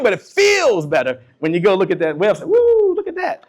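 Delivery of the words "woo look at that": very high, drawn-out "woo"; delighted whoop. Acted. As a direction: whoop as if admiring your own sales figures. Acts out delight at seeing website.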